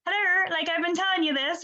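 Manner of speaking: high-pitched, playful indignation; mock exasperation. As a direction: imitate someone fed up at being ignored. Acted exasperation at people not listening.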